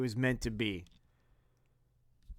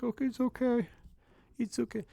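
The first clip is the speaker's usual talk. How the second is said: reassuring dad; 9 semitones higher, 6 dB quieter, faster than usual. Retold or imitated. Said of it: "He's like".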